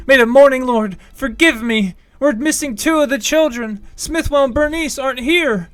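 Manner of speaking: high, loud desperate villager; frantic townsperson calling out; panicked cry. Acted. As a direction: shout in fear for lost children. Villager cries missing children.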